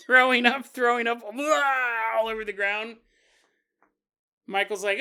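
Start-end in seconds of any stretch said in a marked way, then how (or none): 0.1-3.0 loud, high retching into the words; storyteller mimics Kenneth vomiting, loud and high; acted retching. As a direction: heave and shout it out.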